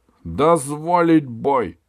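громкий протяжный указ; объяви приговор на весь стадион; королевское заявление. A narrator's reading. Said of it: "продолжал король".

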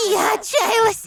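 high, hammed-up cry of desperation; mock desperation. Нарочито отчаянная мольба о роли. (наигранное высокое отчаяние)

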